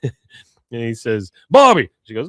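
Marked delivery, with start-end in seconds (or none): 1.5-1.9 sharp call. Retold or imitated. [name] scolding in retold scene.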